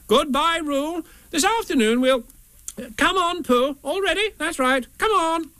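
high, flustered Rabbit voice. Acted. Rabbit rounds up Tigger and Pooh, high flustered voice.

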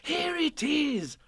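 high, bright excited cry. A narrator's reading. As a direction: call it out with glee. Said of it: "cried Christopher Robin excitedly".

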